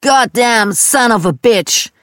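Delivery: slow, loud, high holler; drawn-out, high-pitched cursing shout; screamed curse. A narrator's reading. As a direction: yell it like Dad mid-fight. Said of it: "Dad schrie".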